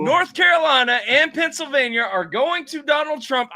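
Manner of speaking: high, loud, sure of himself; confident prediction. Retold or imitated. Streamer replaying his own confident prediction to viewers. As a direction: announce the states like a sure bet. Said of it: "I said".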